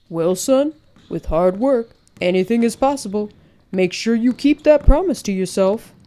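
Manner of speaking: loud, hearty coach voice; rally the boy with conviction; coach's encouragement. Acted.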